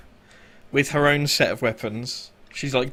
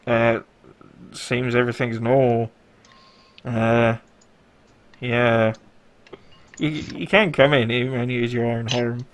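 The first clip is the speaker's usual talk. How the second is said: loud, drawled trooper over a helmet speaker; bored guard over external speakers; stormtrooper helmet speaker; 6 dB louder than usual. Acted. Guard to his squad through the helmet, loud speaker voice.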